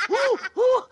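high hooting owl who. Acted. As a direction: hoot it like a wise old owl. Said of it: "the wise old owl".

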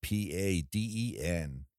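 letters spelled out slowly; slow spelling. Shown as a demonstration. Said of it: "spells it for him too".

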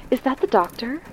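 a hint of a worried woman calling; agitated woman. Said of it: "in an agitated voice".

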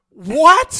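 loud, high incredulous yelp; retold disbelief. Chef retells hearing of a knife-fight challenge, stunned shout. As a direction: blurt it out in exaggerated shock.

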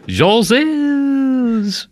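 high, long-held mock French accent; hammed-up French, one long held sound. Shown as a demonstration. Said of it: "give me English words, but the accent".

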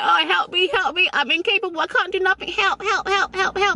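hammed-up helpless damsel crying for help; mock damsel in distress. Mocking herself as a helpless damsel, high. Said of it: "I enjoy being a damsel in distress".

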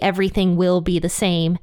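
usual speaking voice

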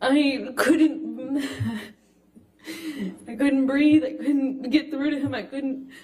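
real sobbing, broken and high, words choked out; barely getting words out through tears; crying. Real, not acted. Breaking down, apologizing, can't continue.

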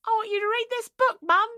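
high-pitched mock Australian boy; hammed-up Aussie child calling for mum; Australian kid. Son asking mum to read, exaggerated Australian accent. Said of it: "'cause he's Australian".

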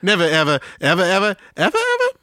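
playful echo climbing into a very high squeak. Pretend. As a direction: step the pitch up with each repeat. Echoes song's rising forever refrain.

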